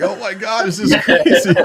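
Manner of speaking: high, laughing re-enacted amazement; laughing disbelief. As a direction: laugh through the astonished reaction. Retold amazed reaction, laughing.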